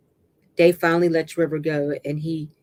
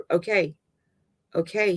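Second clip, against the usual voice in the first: ordinary delivery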